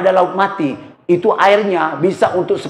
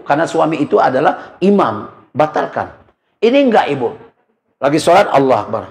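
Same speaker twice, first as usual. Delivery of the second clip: normal talking voice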